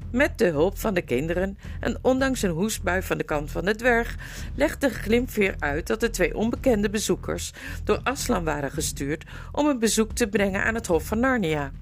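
ordinary delivery, nothing marked

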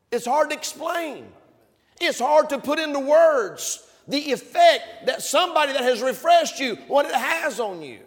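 high, loud fervent preaching; impassioned preaching. Acted. Preacher stirring the congregation, impassioned and loud. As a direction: pour it out with passion.

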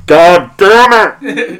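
loud, drawn-out mock outburst; imitated furious yell, stretched out; exasperated sound engineer. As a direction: blow up like a fed-up engineer. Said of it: "he's sitting there going".

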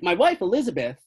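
put-on high, insistent older fellow with a Southern accent. Older fellow insisting he saw a giant, high Southern accent. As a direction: swear to it like a Southern eyewitness. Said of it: "But he's like".

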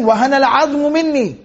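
fast, flowing chanted recitation of the verse; Quran recitation. Acted. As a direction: recite it with tajweed. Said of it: "Zakaria was saying".

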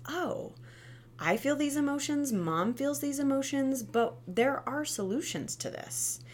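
a hint of a small child discovering feelings; child's voice. Voicing child's realisation.

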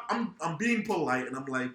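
ordinary delivery

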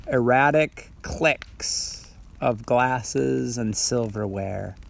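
slow, hushed haiku reading; slow poem reading. Shown as a demonstration. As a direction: read it slowly, letting it fade.

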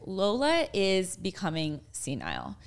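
usual speaking voice

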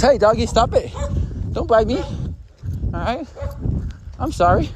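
high-pitched, pleading with a dog; startled pleading. Real, not acted.

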